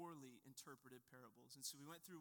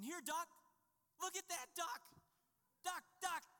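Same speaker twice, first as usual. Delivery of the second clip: put-on much higher and louder, childlike coaxing; kids calling ducks; 12 semitones higher, 7 dB louder than usual. Children coaxing ducks by the reflecting pond, excited childish calls. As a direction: call to the ducks like a toddler. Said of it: "they're there going".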